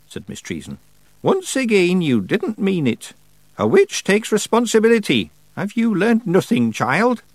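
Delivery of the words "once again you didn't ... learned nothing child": high, loud reprimand; raised-voice witch rebuking a pupil; scolding old witch. A narrator's reading. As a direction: lecture the apprentice sternly. Miss Treason scolding in character.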